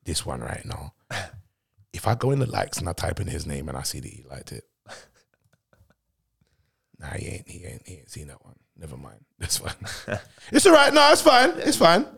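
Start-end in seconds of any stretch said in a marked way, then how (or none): 3.4-10.2 soft, deflated, half under the breath; disappointed mutter. Real, not acted. Sees [name] didn't like it. 10.5-12.1 laughing it off. Real, not acted. Laughing off disappointment.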